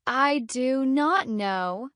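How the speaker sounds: slow, word by word; every word fully separate and drawn out; stiffly careful, one full word at a time; too slow. Shown as a demonstration. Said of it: "Too slow".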